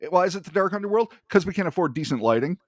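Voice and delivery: playful cartoon overlord; mock villain. Joking as the creature.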